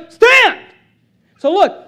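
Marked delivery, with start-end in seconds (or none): 0.1-0.6 very high, loud single-word shout; explosive preacher's cry; shouted command. Acted. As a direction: belt it out like an order. Shouted command from quoted scripture.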